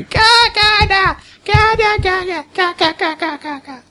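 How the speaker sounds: put-on high sing-song baby babble; alien child babble. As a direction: chant it like babbling alien kids. GM voices alien kids' babble.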